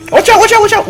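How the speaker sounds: very high, loud rapid alarm; urgent warning. Real, not acted. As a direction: yelp a warning to a teammate. Sudden warning to teammate.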